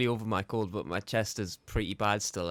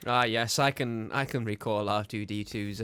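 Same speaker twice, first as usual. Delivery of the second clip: ordinary talk, nothing marked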